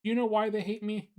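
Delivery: lightly voiced anxious bug; Billy asking. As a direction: rush the question like a worried child. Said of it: "Billy Bollweevil asked".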